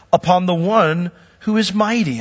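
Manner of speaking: normal talking voice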